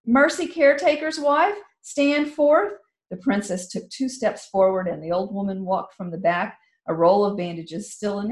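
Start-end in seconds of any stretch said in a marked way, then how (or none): 0.0-2.7 loud, higher summons; commanding call. Acted. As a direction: call them forward with authority.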